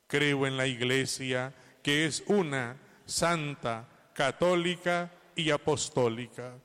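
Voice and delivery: loud, measured communal recitation; creed recitation. Acted. Priest leading the congregation through the creed, loud and measured. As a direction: proclaim it steadily with the congregation.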